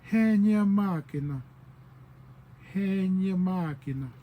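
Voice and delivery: "He nya makina" with a long leading "he"; added "he" sound. Shown as a demonstration. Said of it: "they add in that little part".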